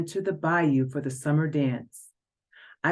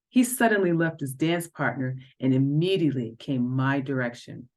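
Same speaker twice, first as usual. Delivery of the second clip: ordinary delivery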